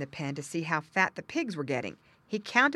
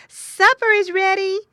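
squealing call; 14 dB louder than usual. A narrator's reading. Mother pig summons missing piglet to eat, squealing call. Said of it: "grunting and squealing as she always did".

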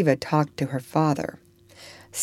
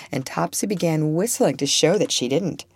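plain reading voice

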